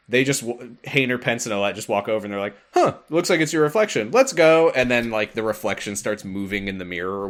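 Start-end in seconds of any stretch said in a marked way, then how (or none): 2.7-4.7 louder, higher, quick acted brush-off; dismissive friend. Roxas's friends waving off the mystery, loud and dismissive. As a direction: voice an oblivious friend hurrying along.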